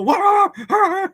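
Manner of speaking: high, loud mock barks; drawn-out imitation of a yapping dog; imitating dog barking. Telling viewers how the friend's dog reacts, imitated barking. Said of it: "the dog go".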